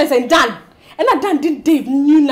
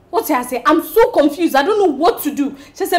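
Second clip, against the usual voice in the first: ordinary delivery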